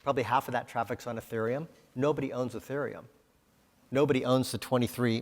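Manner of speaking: ordinary delivery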